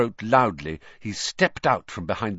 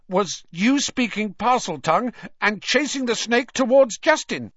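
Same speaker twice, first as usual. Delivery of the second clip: stubborn; 13 semitones higher, 5 dB louder than usual. A narrator's reading. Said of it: "said Ernie stubbornly".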